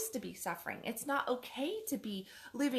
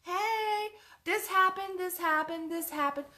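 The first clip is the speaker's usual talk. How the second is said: mock cheery returner; 10 semitones higher, 6 dB louder than usual. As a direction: play someone breezily popping back in after months, much higher and louder. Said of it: "they're like".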